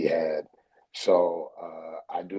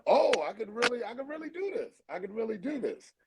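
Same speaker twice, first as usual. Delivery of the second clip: much higher, squealing with delight; excited realization; 13 semitones higher, 4 dB quieter, faster than usual. Retold or imitated. Comedian recalling fans' praise, squealing with high delight. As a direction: squeal it like you just found your gift.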